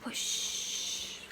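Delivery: soft, fading unvoiced whoosh. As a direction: let it drift away quietly. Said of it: "says Honey".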